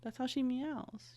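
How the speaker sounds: normal talking voice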